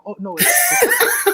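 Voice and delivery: breaking into a high laugh. Real, not acted. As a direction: laugh through the words. Laughs at his own joke.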